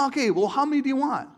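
high, loud question; dad calling out. Retold or imitated. Dad asking the kids how many Happy Meals, loud and playful. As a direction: tease the kids with a big offer. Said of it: "He's like".

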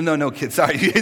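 ordinary talk

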